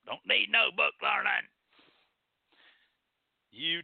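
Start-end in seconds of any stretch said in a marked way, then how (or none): 0.1-1.5 higher-pitched mock country accent; mock country folk. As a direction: put on a backwoods country twang. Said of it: "But people say".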